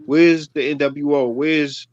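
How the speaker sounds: ordinary delivery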